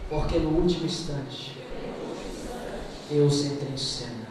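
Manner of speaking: low, subdued sermon voice; grave, low-pitched pulpit address; hushed solemn preaching. Real, not acted. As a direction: drop low and let each phrase settle.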